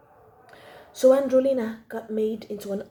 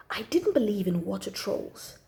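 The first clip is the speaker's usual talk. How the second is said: ordinary delivery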